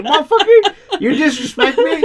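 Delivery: high, loud, laughing mock outrage; angry doll voice. Co-host voicing Robert the doll, high and laughing. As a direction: squeal it like an offended doll. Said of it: "Robert's just like".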